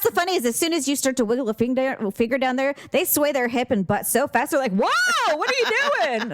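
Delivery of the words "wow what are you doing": high-pitched imitated alarm; mock startled guy. Imitating guys reacting to the finger, mock alarm. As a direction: yelp it out like someone caught off guard.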